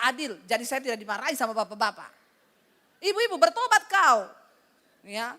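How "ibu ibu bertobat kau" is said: shouted call to repent. Acted. Preacher calls wives to repent.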